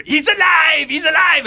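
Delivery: a mad scientist crying out that his creation lives. Pretend. He brings his character Quill to life like a mad scientist, shouting.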